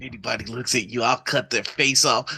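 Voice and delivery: low, menacing obsessive yandere. Retold or imitated. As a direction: growl it like a jealous yandere. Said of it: "unhinged psychopath like Yandere".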